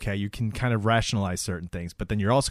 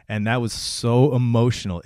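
normal talking voice

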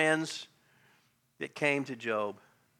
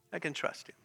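much softer, sincere affirmation; hushed repeat; 10 dB quieter than usual. Real, not acted. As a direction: let it drop to a quiet conviction. Repeats his shouted declaration softly.

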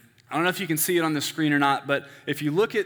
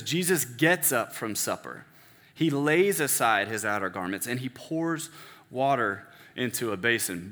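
storyteller's reading; 4 semitones lower than usual. Shown as a demonstration. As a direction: read it as if happening in front of you. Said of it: "So it would read something like this".

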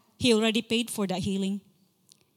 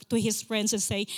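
ordinary talk, nothing marked

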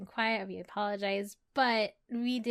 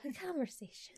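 whisper; 6 semitones higher, 8 dB quieter than usual. Real, not acted. Whispered answer.

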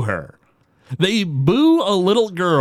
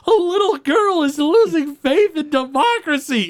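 much higher, earnest panic; alarmed plea; 13 semitones higher than usual. Retold or imitated. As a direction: sound scandalized for democracy. Page pleading on the phone.

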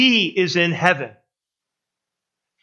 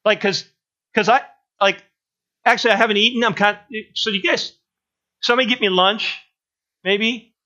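higher, louder, clueless and chatty; dim-witted gunman; 4 semitones higher, 4 dB louder than usual. Pretend. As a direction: play a dopey guy asking for lunch mid-raid. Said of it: "imagine the stupidity of one of those guys".

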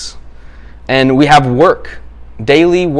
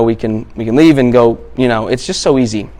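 ordinary talk

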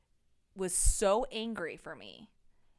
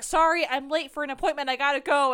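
hurried, louder, higher excuse to escape; flustered excuse; 5 semitones higher, 8 dB louder than usual. Retold or imitated. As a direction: blurt an excuse and bolt. Fleeing priest, excuse to leave.

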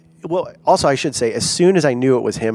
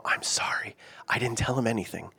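hushed, whispery aside, much softer; whispered apology; 2 semitones lower, 11 dB quieter than usual. Retold or imitated. Leader to team members looking over at him, whispered apology. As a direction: whisper it like a secret disclaimer.

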